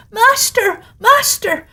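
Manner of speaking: very high, piercing cry; a small magic harp crying for help; the golden harp calling its master in a very high voice; harp calling loudly. A narrator's reading. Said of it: "the harp cried out".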